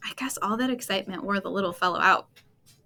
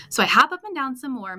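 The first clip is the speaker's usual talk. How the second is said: higher and louder, worked-up telling; mad frog; 4 semitones higher, 5 dB louder than usual. A narrator's reading. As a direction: push it out like an upset frog. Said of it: "mad like a muskrat".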